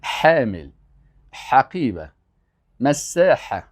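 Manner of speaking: careful pharyngeal ح in "حامل", "حقیبه", "مساحه"; throaty Arabic ح. Shown as a demonstration. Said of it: "باید از حلق تلفظ کنی".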